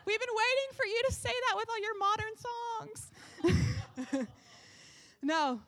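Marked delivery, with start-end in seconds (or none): 0.1-4.3 much higher, smug hymn-lover voice; mock the traditionalists, lightly. Congregation member who loves hymns, in a lightly voiced smug reply. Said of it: "some of y'all are like".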